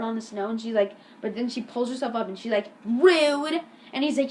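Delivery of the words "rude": louder, higher indignant cry; mock indignation. Elisa pushed in the snow, mock indignant shout. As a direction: imitate an offended girl calling it out.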